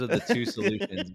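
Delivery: high, laughing through the words. Real, not acted. Laughing at own joke.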